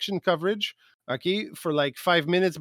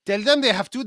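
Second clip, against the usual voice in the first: mock French accent, repeated higher and louder; 6 semitones higher, 5 dB louder than usual. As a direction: push "Tell them they have to" up higher and louder in the French accent. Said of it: "he's, he's French".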